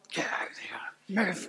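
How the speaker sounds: hushed impatient aside in church; muttered under breath. Retold or imitated. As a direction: grumble it quietly to yourself. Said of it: "And I'm like".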